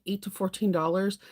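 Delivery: ordinary talk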